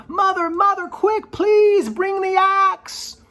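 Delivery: very high, loud cry, slowing on bring the axe; calling out urgently. Acted. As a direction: yell down to his mother from the beanstalk. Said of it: "he called out to his mother".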